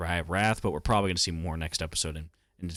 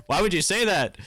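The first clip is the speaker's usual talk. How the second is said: higher and louder, hammed-up disbelief; mock outraged yell; 10 semitones higher, 4 dB louder than usual. Crowd scolding Subaru for his comment, mock outraged yell. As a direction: shout it like the crowd can't believe him. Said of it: "Everyone's like".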